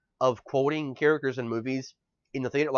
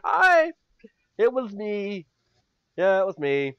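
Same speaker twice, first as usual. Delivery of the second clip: playful confession; 7 semitones higher, 4 dB louder than usual. Pretend. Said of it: "I'll be like".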